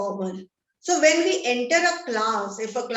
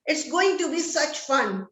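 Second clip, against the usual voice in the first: usual speaking voice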